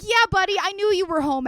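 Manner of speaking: loud, high, exasperated comeback; irritated mock retort. Streamer answering a friend's text aloud, irritated. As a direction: fire it back at the friend, peeved.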